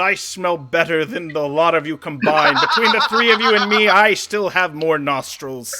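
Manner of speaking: loud gloating troll with a laugh; dopey cartoon bellow. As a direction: boast like a dim troll.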